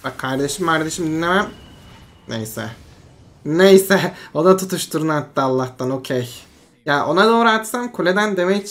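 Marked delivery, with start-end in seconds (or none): 2.3-2.7 low, soft "neyse"; subdued resignation. Real, not acted. Gamer shrugging off a failed move, subdued. Shrugs off a mishap, first take. 3.4-4.1 loud, punched "neyse"; emphatic dismissal. Real, not acted. Gamer shrugging off a failed move, loud and emphatic. Repeats it, louder second take.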